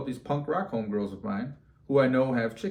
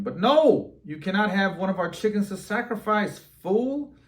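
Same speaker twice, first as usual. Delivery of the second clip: put-on punk girls refusing; 8 semitones higher, 4 dB louder than usual. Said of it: "they're like".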